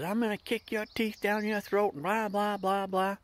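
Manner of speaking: high, put-on menacing voice; mock the angry guy's threat; hammed-up tough guy; imitated thug threat. Retold threat from stranger.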